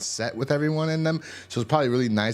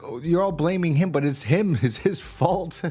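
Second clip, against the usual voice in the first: higher, laughing through a mock quote; mocking Andrea's blame of Merle, pitched up with laughter; laughing mockery; 6 semitones higher than usual. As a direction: laugh while mimicking her excuse.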